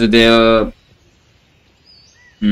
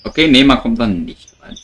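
usual speaking voice

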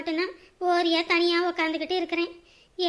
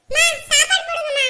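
much higher, loud call for food; 10 semitones higher, 8 dB louder than usual. Acted. Hungry young man calls to the mother for food, loudly. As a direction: holler for mom to serve dinner.

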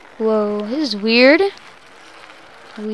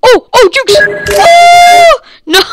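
panicked shout; 9 dB louder, slower than usual. Real, not acted.

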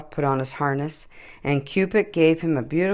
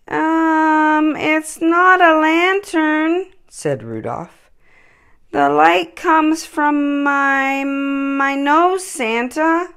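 much higher, slow, hesitant little reindeer; shy Rudolph; 12 semitones higher, much slower than usual. A narrator's reading. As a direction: bashfully confess.

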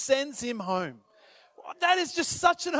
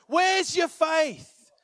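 drawn out, slow and raised; slow emphasis; 4 semitones higher, much slower than usual. Acted. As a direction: stretch each word slowly. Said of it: "slow emphatic repeat".

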